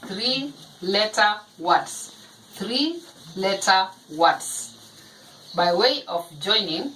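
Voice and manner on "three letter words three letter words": term spelled out slowly, word by word; slow teaching emphasis. Shown as a demonstration. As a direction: dictate it slowly to the class. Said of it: "We call them".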